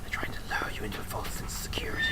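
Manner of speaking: hushed conspiratorial whisper; spooky whisper. Pretend. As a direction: whisper a dark warning. Spooky commentary.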